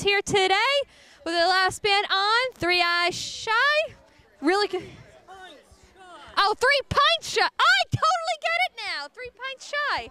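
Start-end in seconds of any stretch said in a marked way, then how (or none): 6.4-10.0 realization. Real, not acted. Realizes pun in band name.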